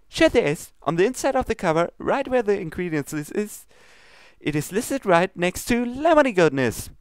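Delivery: high, bubbly pony voice, pleading; sweet baker begging her not to waste cupcakes; high Pinkie Pie voice. Acted. Pinkie pleads with Princess Luna, high and bubbly.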